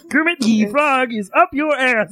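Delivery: slow, high Kermit croon; slow froggy puppet voice. Retold or imitated. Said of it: "saying Kermit".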